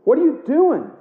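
high, loud anguished cry of please stop; mock pleading scream. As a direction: plead at the top of your voice. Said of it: "I'm screaming".

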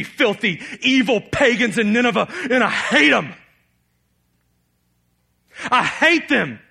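loud, high, furious complaint to God; angry Jonah. Acted. Jonah angrily protests God's mercy to Nineveh, shouting. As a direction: rail at God, pitch up and loud. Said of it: "And he was angry".

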